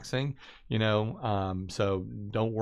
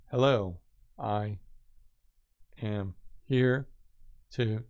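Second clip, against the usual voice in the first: halting word-by-word reading; slower than usual. Shown as a demonstration. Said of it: "read one word at a time".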